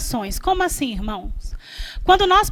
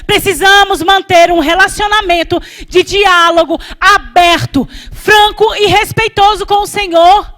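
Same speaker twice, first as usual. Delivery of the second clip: emphatic, raised sermon voice; impassioned preaching; 2 semitones higher, 4 dB louder than usual. Real, not acted. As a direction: press each phrase home to the congregation. Preaches at full force.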